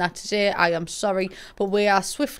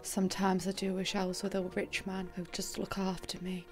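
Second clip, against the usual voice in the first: soft, hushed confession; whisper; 12 dB quieter than usual. Pretend. Wishing for a rich man, confiding whisper. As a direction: whisper it like a secret wish.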